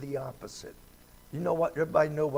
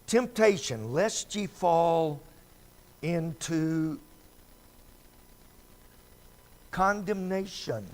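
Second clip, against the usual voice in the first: louder, slow solemn verse; scripture recitation; 2 semitones higher, 4 dB louder than usual. Acted. Preacher solemnly quoting the verse, louder and slow.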